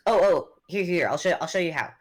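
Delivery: excited helper cutting in; eager offer. Acted. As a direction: jump in, keen to show how. Guy eagerly offers to demonstrate snow rolling.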